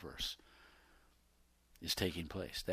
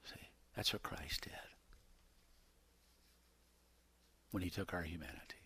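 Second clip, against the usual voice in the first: sudden hushed whisper, much softer; 5 semitones lower, 12 dB quieter than usual. Acted. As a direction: draw them in with a whisper. Preacher drops to a whisper.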